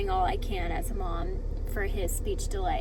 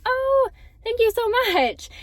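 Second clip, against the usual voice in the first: high, slow, much louder gushing thank-you; squealing with flattered delight; thrilled thanks; 12 semitones higher, 8 dB louder than usual. Retold or imitated. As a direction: gush back, flattered and delighted. Her retold thrilled reply.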